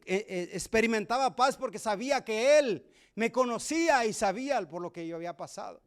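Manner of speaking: ordinary talk, nothing marked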